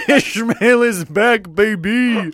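high, loud, drawn-out comeback cry; hammed-up celebratory holler; gleeful mock announcement. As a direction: stretch it out like a triumphant return. Gleefully announcing the character's return.

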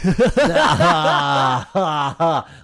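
loud laugh. Real, not acted. Host laughs at cohost joke.

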